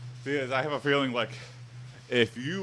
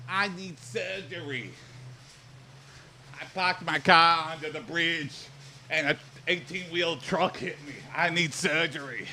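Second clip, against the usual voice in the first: Boston accent, hammed up a little; 3 semitones higher than usual. Shown as a demonstration. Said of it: "in a Boston accent".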